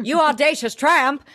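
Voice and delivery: high, playful outraged insult; milder mock scream. Host repeating the favourite insult, lighter mock outrage. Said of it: "That's what I wanted him to scream".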